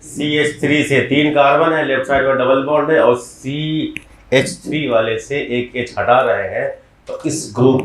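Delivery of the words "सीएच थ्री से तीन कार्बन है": ordinary talk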